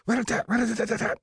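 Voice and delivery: high, rapid-fire rat-a-tat imitation; child's machine-gun noises. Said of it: "He made airplane noises, ... and went".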